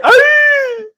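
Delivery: sudden high pained yelp. Retold or imitated. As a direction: squeal like you got smacked. Man yelps when hit.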